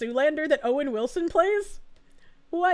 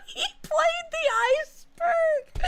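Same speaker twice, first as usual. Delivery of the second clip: high, slow, breaking into laughter; laughing through words; 11 semitones higher, slower than usual. Real, not acted. Laughing at a chat joke about the iceberg. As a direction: squeak it out while cracking up.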